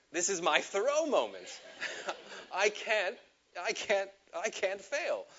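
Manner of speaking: high, laughing mock drama. A jailed teen laughing at his own big moment. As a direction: laugh through the teenage bravado.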